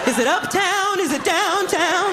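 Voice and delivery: high, slow, sing-song lost tourist voice; confused tourist. Retold or imitated. Comic mocks tourists at the subway map, high sing-song. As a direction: drag it out like a baffled tourist at the map.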